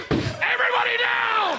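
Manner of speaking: very high, loud barked command; raider's shout. Acted. Robber bursting into a house, screaming the order. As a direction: yell like a raider storming in. Home invasion image.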